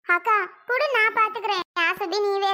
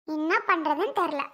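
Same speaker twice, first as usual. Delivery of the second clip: ordinary talk, nothing marked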